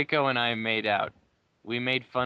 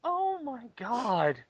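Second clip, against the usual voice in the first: incredulous squeak; 11 semitones higher, 4 dB quieter, slower than usual. Real, not acted. Reacting to absurd reveal.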